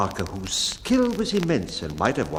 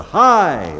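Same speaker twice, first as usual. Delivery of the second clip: a sharp, much louder and higher call of Hi; loud cry; 7 semitones higher, 10 dB louder than usual. A narrator's reading. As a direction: call out Hi across the deck. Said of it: "or to any loud cry".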